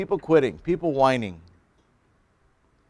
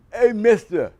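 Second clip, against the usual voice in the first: mimicking classmate; 8 semitones higher, 4 dB louder than usual. Seminary classmate calls the pastor over, imitated. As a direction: call a stranger over, gently imitated. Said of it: "I'm gonna mimic him".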